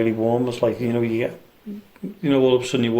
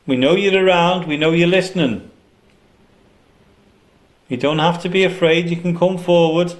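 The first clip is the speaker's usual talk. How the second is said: raised voice, coaxing the unseen; calling out; 4 semitones higher than usual. Real, not acted. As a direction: call out firmly but kindly. Calling out to spirits.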